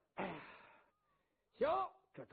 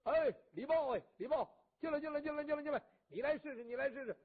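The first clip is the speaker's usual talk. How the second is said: loud, higher beckoning call; calling a sworn brother in to try the bow, loud and eager; calling over; 5 semitones higher, 4 dB louder, faster than usual. Acted. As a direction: wave your friend in, urging him loudly. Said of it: "冲着李如归一招手".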